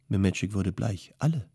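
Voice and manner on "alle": a single word, high and shocked; stunned question. A narrator's reading. Memetschik hears his family was exiled, stunned disbelief.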